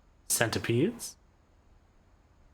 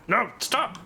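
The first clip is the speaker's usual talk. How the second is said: high startled cry; panicked shout; 11 semitones higher than usual. Real, not acted. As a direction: yelp it in alarm. Panicked reaction in game.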